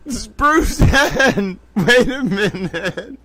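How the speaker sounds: high, tearful, giggly voice; barely holding the laughter in; laughing to tears. Real, not acted. Host finds another term, tearful with laughter. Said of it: "I'm crying. Te-, towel tears of laughter".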